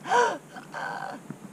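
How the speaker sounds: high choking gasp; strangled gasp. Acted. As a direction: gasp like a fish out of water. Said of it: "a series of strangled gasps".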